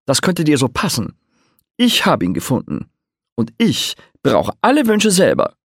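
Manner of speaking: put-on guard your treasure like a spoiled child; greedy bard.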